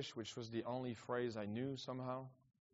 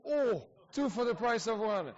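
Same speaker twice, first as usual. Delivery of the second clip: higher and louder, delighted; excited exclamation; 9 semitones higher, 8 dB louder than usual. Real, not acted. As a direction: cry it out happily at the double question. Two guests asked at once.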